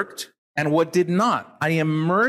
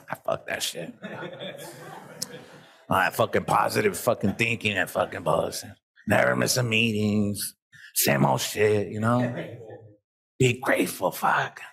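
lower, muttered mock grumbling; dismissive grumble; 6 semitones lower, 4 dB quieter than usual. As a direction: sneer at positive thinking under your breath. Imitating relapsed, dismissive self.

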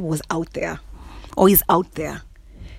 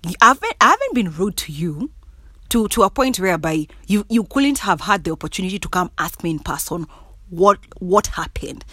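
ordinary talk

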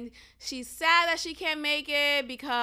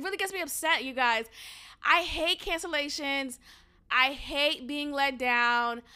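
ordinary talk, nothing marked

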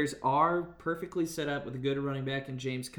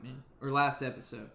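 ordinary talk

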